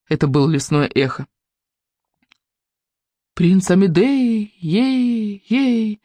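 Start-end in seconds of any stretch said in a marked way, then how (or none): 3.3-6.0 slow booming echo, dragged out with fading repeats; thundering echo. Acted. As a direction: call it out like an echo over the forest. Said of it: "прогремело над ней".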